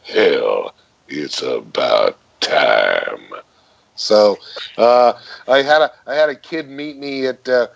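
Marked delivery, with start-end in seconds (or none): 0.0-3.3 deep, slow, drawn-out catchphrase; Takis J. Finley voice. Shown as a demonstration. Actor giving fans his famous deep line, slow. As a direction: sink low and stretch it out. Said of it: "accustomed to hearing me say".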